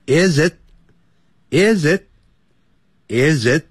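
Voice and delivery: "is it" slow, with the t held and not released; stopped t. Shown as a demonstration. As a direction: brake on the t of "is it". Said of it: "刹车，刹住".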